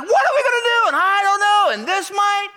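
high, loud mock panic; panicked followers, hammed up and frantic; freaking out. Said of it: "They're freaking out".

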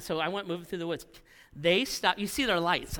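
usual speaking voice

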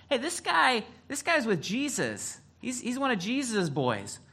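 high-pitched mock girl voice; another little girl. Second girl pointing out Peter to others, high mock accusing voice. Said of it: "another little girl comes up".

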